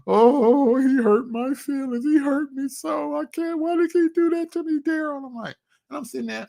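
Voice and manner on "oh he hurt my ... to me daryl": much higher, slower whiny sobbing voice; mock crying baby. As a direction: whine it like a crybaby mocking him. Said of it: "crying like a baby".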